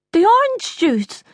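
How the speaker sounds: high-pitched, thick-tongued speech; swollen tongue. Acted. Mother complaining to Ryan about the juice, swollen-tongued. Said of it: "your tongue is swollen".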